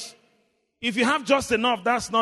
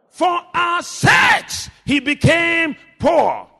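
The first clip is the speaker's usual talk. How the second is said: loud, higher, drawn-out sermon delivery; forceful preaching; 8 semitones higher, 9 dB louder, much slower than usual. Real, not acted. Preacher reading scripture to the congregation, forceful preaching. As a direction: proclaim the verse to the congregation. Proclaims verse at full force.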